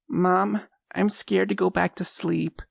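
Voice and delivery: put-on high child's voice, frightened and pleading; a small boy telling his mom about the clown; scared little boy. A narrator's reading.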